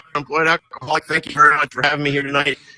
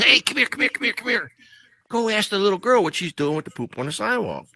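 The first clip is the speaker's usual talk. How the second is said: higher, eager calling out to a buddy; excited calling over; 7 semitones higher, 4 dB quieter than usual. Retold or imitated. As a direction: wave him over, can't wait to share the joke.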